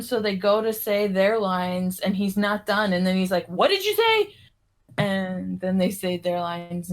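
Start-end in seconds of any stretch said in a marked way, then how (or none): 3.5-4.3 high, sudden mock demand; mock actor outburst. Hammy actor cutting in on his castmates, mock demand. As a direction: pop the question up like a hammy actor.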